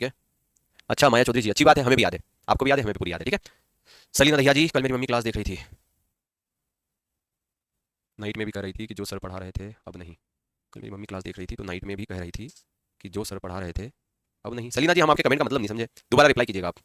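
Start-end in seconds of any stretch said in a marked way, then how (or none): 4.7-14.7 very soft, low-pitched read to himself; low reading aloud. Real, not acted. Teacher reading a student's comment about mother, low murmured reading. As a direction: puzzle through the comment quietly, low voice.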